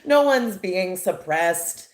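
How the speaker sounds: high, loud mock-cheerful employee; hammed-up forced cheer; fake happy. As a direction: pretend all is wonderful, sarcastically over-bright. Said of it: "pretend to be happy employees".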